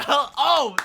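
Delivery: high sudden yelp; shocked yelp. Real, not acted. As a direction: yelp in disbelief. Shocked cry at bad tile.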